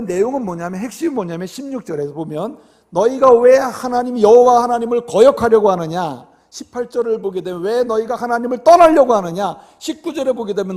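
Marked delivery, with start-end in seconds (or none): 2.9-6.3 raised voice, building to a loud rebuke; retold accusation, driven home loudly; acted scolding. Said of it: "막 야단을 치고 비판하는". 7.6-9.6 loud retold rebuke; acted scolding. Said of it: "막 야단을 치고 비판하는".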